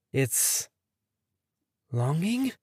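soft, hushed, almost whispered; hushed wonder. A narrator's reading. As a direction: breathe the question in awe.

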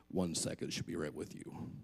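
low, whispery fake voice; deep hushed put-on voice; disguised voice. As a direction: hide your own voice from the telemarketer. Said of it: "I said".

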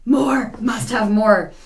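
slow, exaggerated craving; mock craving. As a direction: stretch it out like a hammed-up addict begging. Acts out craving after first sip.

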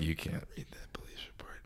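whisper. Retold or imitated. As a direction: breathe it out under the voice. His reply to mom, hushed.

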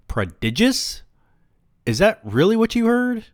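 mock incredulous. A narrator's reading. Mocking echo of word.

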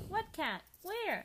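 high, loud startled questions. Acted. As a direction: jump in alarm. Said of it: "asked Pepito".

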